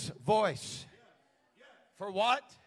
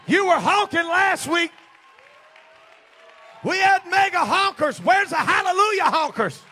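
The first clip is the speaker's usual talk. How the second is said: high, shouted exhortation; fired-up preacher's challenge; rousing full-force preaching; 11 semitones higher, 15 dB louder than usual. Real, not acted. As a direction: shout to stir up a congregation. Rousing the congregation.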